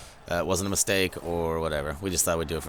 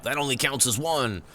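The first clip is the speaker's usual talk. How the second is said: Gimli shout; 5 semitones higher than usual. Retold or imitated. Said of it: "I love it when he shouts it out".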